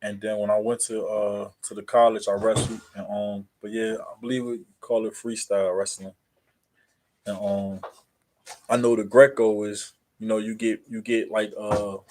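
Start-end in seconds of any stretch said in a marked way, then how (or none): none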